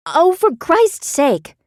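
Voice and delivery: high, loud, slow exasperated cry; exasperated outburst. A narrator's reading. Beth fed up with Benny's questions, exasperated outburst. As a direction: let it burst out of her.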